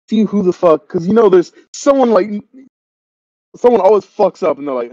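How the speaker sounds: ordinary delivery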